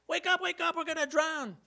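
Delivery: high, urgent mock alarm; panicked cry. Frightened disciples shaking Jesus awake in the storm, panicked.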